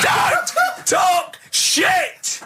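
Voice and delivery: stretched-out high yell of disbelief; outraged shout. Real, not acted.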